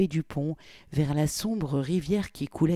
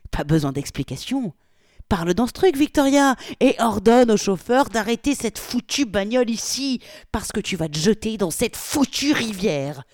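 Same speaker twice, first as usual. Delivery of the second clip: angry shouting; 7 semitones higher, 6 dB louder than usual. Acted. Polly shouting orders at Victoria.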